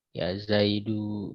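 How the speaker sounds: "Ya Zaidu" drawn out, with the final u clearly sounded; very slow demo. Shown as a demonstration. Teacher slowly models the call ending for students. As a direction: stretch "Zaidu" and land on its u. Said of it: "contohnya ya".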